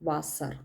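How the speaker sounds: വാസർ said softly as German "Wasser"; German pronunciation. Shown as a demonstration. Teacher repeating the German word after spelling. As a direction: say വാസർ with a v sound. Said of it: "വാസറിനെ water എന്നാണ് പറയുന്നത്".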